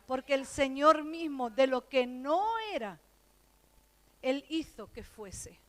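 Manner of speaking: loud emphatic preaching; preaching at full force. Real, not acted. Preacher declaring God's power, loud emphatic preaching. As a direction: proclaim it to the congregation.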